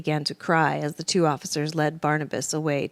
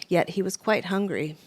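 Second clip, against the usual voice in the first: ordinary delivery, nothing marked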